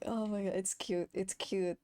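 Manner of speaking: ordinary talk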